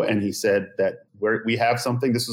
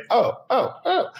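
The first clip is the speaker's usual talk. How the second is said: mock realization; 13 semitones higher than usual. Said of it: "in my head, I was just like".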